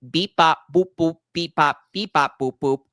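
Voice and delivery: imitated electronic babble; nonsense beeps and boops. Shown as a demonstration. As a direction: spout beep-bop-boop like meaningless machine babble. Mocks wisdom as meaningless noise.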